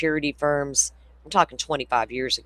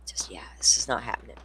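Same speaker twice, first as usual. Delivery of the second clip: fast, softer aside, whispered; 4 semitones lower, 5 dB quieter, faster than usual. Real, not acted. Telling chat she won't take dogs home, whispered. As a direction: hush it like a secret refusal.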